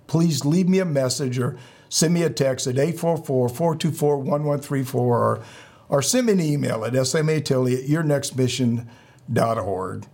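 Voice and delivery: ordinary delivery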